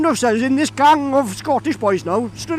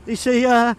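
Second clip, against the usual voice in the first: plain reading voice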